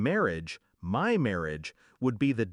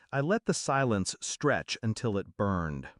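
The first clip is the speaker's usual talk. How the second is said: ordinary delivery, nothing marked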